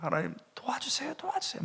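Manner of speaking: high, breathless whispered begging; weeping plea. Retold or imitated. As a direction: whisper it high through tears. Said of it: "막 우는 거예요".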